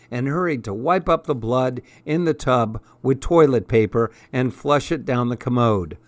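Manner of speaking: ordinary delivery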